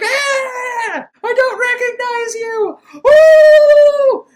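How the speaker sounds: high, loud hammed-up screaming; mock scream. Reviewer mimicking Ahmed's over-the-top scream, loud and high. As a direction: shriek it like a bad horror actor. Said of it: "He's just like".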